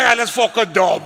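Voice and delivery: loud, high retold retort; emphatic shout. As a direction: shout the verdict, laughter close behind. Quotes own reply to Danny.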